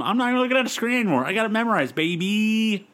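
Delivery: quick, high, cocky boast; cocky fast brag. Real, not acted. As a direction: rattle it off smugly. Bragging rapidly.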